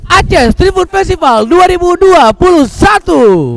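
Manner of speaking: slow, loud festival name call; announcer voice. Acted. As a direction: boom out the event name. Co-host completes opener. (suara pembawa acara lambat dan keras)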